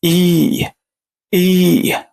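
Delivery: long "إي", a held "ee"; third E sound. Shown as a demonstration. Quick review of the third pronunciation before practice, long "ee" on "إي". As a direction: hold "إي" like the name of the letter E. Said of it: "النطق التالت".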